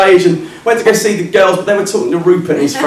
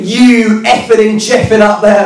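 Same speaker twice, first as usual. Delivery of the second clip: slow, higher-pitched mock complaint; indignant heckler; 4 semitones higher, slower than usual. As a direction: drag out the accusation, affronted.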